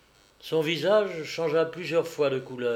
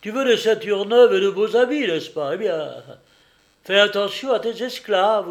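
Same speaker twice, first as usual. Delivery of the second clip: loud, raised-pitch blustering; heated, hectoring outburst; angry warning; 6 semitones higher, 8 dB louder than usual. A narrator's reading. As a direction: scold forcefully, ending in a curse. Man cajoles, warns, then curses slave.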